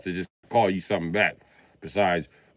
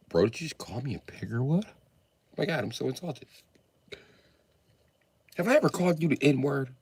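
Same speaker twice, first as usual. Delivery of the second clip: lightly voiced friend, softer, mock hurt; mock offended friend; 5 semitones higher, 7 dB quieter than usual. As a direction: play the friend pretending to be insulted.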